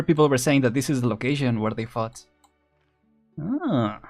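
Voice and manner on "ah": ordinary delivery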